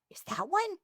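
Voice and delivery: high-pitched feigned wonder; mock naive curiosity. Hostess feigning ignorance about who swingers are, mock naive curiosity. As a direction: ask high, playing clueless. Playing innocent, pointing out swingers.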